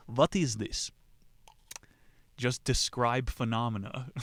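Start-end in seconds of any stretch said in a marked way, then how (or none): 0.1-0.9 put-on What is this said with a light Russian accent, the W turned to V; a hint of Russian in What is this; mock Russian accent. Locals mistaking the speaker for Russian, a light mock Russian accent. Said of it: "Are you Russian?".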